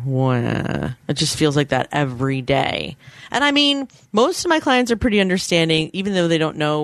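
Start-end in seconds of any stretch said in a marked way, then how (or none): 0.0-0.9 low, drawn-out pretend crying; a half-joking, low moan of self-pity; mock guilty wail. Mimicking own morning guilt over clients, mock guilty wail. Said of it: "every morning I wake up going".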